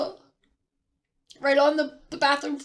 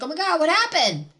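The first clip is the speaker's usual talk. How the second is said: panicked; 5 semitones higher, 5 dB louder than usual. Retold or imitated. Wife finds husband screaming.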